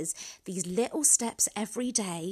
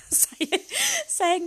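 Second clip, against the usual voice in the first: high, laughing stumble on the word; real giggle through the words; laughing restart; 10 semitones higher, 4 dB louder than usual. Real, not acted. Host caught out by her own negative saying, laughing.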